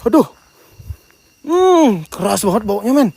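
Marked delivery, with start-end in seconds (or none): 1.5-2.0 sharp high hum at a stench; high rising "hmm" of disgust. Real, not acted. Strong smell at the tree.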